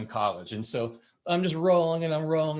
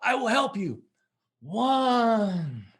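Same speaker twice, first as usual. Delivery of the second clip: helpful bandmate; 8 semitones higher, much slower than usual. Retold or imitated. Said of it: "And he goes".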